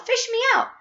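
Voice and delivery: very high, pleading yell; yelling for help. A narrator's reading. Augustus begs from the river, high yelling. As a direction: cry out to be rescued. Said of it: "he yelled".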